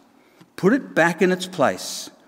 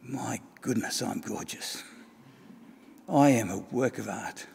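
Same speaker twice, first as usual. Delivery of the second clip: soft, lower, awed whisper of self-admiration; hushed wonder; 5 semitones lower, 9 dB quieter than usual. Acted. Cup seeing itself in the glasses, hushed amazed admiration. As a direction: marvel quietly at your own beauty.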